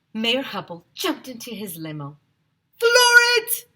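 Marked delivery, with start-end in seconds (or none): 2.8-3.7 much higher, loud command bark; yelling. A narrator's reading. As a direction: yell at the driver to go. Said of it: "he yelled to his driver".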